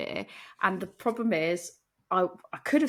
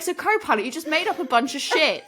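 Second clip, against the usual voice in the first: mock accusing; 7 semitones higher, 4 dB louder than usual. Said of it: "And I was like".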